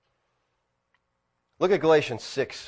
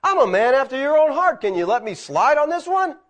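a rising, louder plea as David; pleading David; 10 semitones higher, 4 dB louder than usual. Retold or imitated. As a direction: beg God to let it slide, voiced as David.

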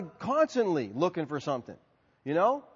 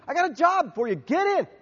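high, pressing job offer; acted-out pushy invitation; insistent urging; 9 semitones higher, 4 dB louder than usual. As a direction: press the offer on a reluctant stranger. Acting out urging a panhandler.

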